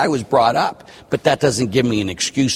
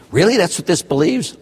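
lightly incredulous, higher pitched question; mock surprise; 7 semitones higher than usual. Priest voices churchgoers learning the teaching, mildly incredulous. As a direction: act a touch taken aback.

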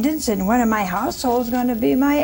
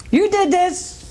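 high accusing blame; 8 semitones higher than usual. Acted. Sarah blames Abraham.

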